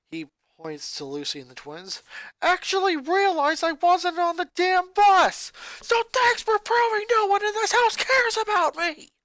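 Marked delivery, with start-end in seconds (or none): 2.4-9.1 put-on high, loud furious boy shouting at family; a boy exploding at his mother; angry boy ranting. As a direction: snap back at Mom, furious. Lincoln bitter.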